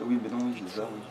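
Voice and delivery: usual speaking voice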